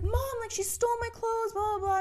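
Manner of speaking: high whiny tattling voice; whiny kid sister. Retold or imitated. Younger self complaining to mom, high and whiny. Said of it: "I was like".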